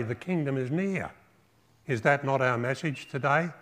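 ordinary delivery

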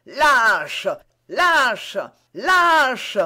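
full-voiced outraged shout, rising each time. A narrator's reading. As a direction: yell the insult after someone fleeing. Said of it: "en criant encore".

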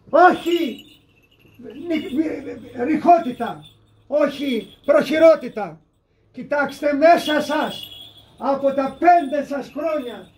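high, loud, slow exhortation; forceful preaching. Retold or imitated. Saint exhorting Christians to look inside themselves, forceful preaching. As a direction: preach it out, stretching the key words.

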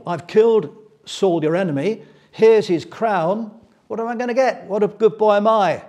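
loud, high, slowed mock boasting; smug boast. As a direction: ham up a greedy messenger fishing for praise. Amalekite boasting for reward.

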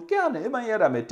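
a touch of a sly, eager servant voice; greedy valet. Acted. Valet happily taking his master's cast-offs, lightly voiced and eager.

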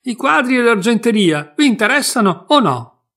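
very loud, high shout; brash loud demand; shouting at full voice. A narrator's reading. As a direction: yell it impatiently. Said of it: "gridò a gran voce".

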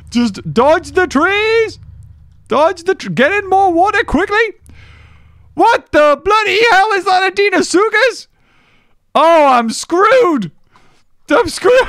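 frantic high yelling while fleeing; panicked high-pitched cries; panicking. Real, not acted. Chased by a dinosaur, panicking.

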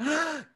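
mock high gasp of shock. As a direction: gasp in pretend astonishment. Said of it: "Gasp in Spanish".

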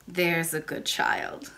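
put-on slow, low old lady's voice; kindly old lady. A narrator's reading. Old lady praises the little princess, gently approving. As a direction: soothe the child, slowly and low.

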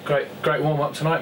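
usual speaking voice

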